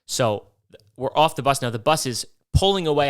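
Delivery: ordinary talk, nothing marked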